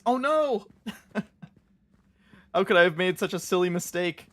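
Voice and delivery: put-on high, bubbly valley girl squeal. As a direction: gush it like a ditzy valley girl. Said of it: "your real valley girl voice".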